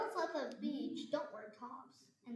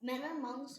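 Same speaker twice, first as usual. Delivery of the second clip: normal talking voice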